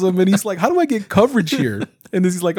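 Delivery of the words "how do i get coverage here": much higher, mock-astonished question; retold bafflement. Retelling the hero's call from his wife, mock-baffled. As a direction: imitate a man amazed his phone works.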